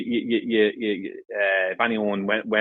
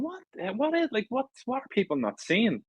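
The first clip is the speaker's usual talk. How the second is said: exasperated disbelief; 11 semitones higher, 4 dB quieter than usual. Retold or imitated.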